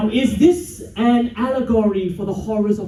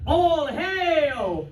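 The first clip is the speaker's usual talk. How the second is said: long, drawn-out ceremonial call; ceremonial hail; 4 semitones higher than usual. Acted. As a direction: hail the king for a crowd to echo. Leads crowd in a chant.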